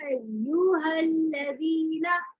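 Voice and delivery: अय्युहल्लज़ीना read slowly with the voice lifted a little on the doubled य; nabr on the doubled ya. Shown as a demonstration. Teacher showing students how to read अय्युहल्लज़ीना without pressing. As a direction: slowly raise the voice slightly on य्यु in अय्युहल्लज़ीना. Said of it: "आवाज थोड़ी सी यहाँ पर raise हो जाएगी".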